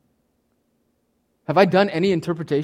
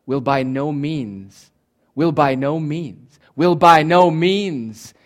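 slow, weighty "Will by no means", building louder; emphatic negative; 4 dB louder, much slower than usual. Shown as a demonstration. Preacher stressing the Greek negative, slow and emphatic. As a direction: hammer out "Will by no means" word by word, louder on the last. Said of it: "that's called an emphatic negative".

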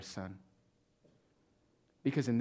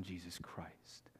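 ordinary delivery

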